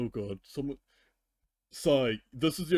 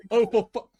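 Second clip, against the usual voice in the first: exasperated outburst; 10 semitones higher, 9 dB louder than usual. Retold or imitated. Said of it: "No, I was like".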